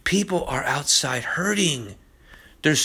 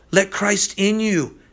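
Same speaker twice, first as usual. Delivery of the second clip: ordinary talk, nothing marked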